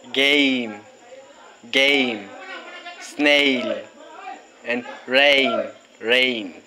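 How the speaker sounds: each word said twice, clearly, long A drawn out; long A vowel words. Shown as a demonstration. Teacher modelling long A words for kids. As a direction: model "cake", "train", "mail" with a clear long A. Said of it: "let's try these words with a long E sound".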